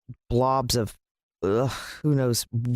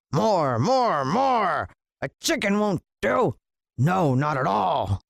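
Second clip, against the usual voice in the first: rising, greedy cry of more; greedy rant; 3 semitones higher, slower than usual. A narrator's reading. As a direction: demand it hungrily, building. Greedy villain ranting.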